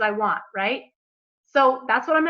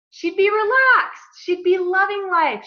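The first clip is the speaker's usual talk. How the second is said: dreamy relaxed; 8 semitones higher, 5 dB louder, slower than usual. Pretend. Imagining her relaxed future self.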